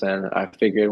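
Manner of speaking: normal talking voice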